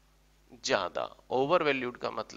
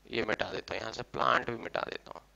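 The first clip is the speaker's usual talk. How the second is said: soft, low aside to oneself; quiet, absent-minded murmur; muttering while erasing; 4 semitones lower, 8 dB quieter, faster than usual. Real, not acted. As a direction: talk under your breath as you wipe.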